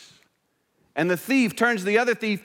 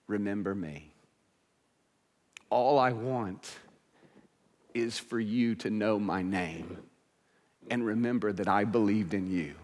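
soft plea; 8 semitones lower, 6 dB quieter than usual. Acted. Dying thief pleads with Jesus.

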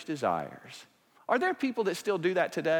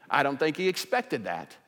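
usual speaking voice

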